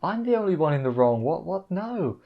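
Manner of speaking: high, hushed, stammering protest; a quiet, flustered stammer as if caught off guard; flustered disbelief. Retold or imitated. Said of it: "and he's just like".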